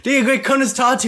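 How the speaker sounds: loud, high mock shout; retold shout. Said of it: "I'm after shouting".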